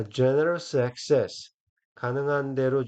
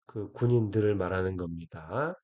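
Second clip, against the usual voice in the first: ordinary talk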